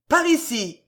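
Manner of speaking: high-pitched urgent call; called-out cry. A narrator's reading. Roussotte guiding the troop in, urgent cry. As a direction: call them over, pitch leaping up. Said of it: "cria la Roussotte".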